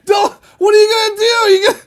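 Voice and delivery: high, loud alarmed shout. Retold or imitated. Patient shouting at nurse holding needle, alarmed and loud. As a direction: demand what she means to do. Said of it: "I'm like".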